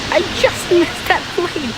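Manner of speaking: high and slow, amazed; excited. Real, not acted. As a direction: marvel at the near miss. Close call just seen.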